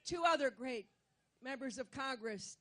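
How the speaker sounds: ordinary talk, nothing marked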